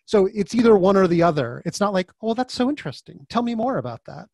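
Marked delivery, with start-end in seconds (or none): 2.2-4.3 much higher, intrigued tone; acted-out gentle fascination; mock curiosity. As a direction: play the interested, open-minded listener. Imagined curious reply to inner critic.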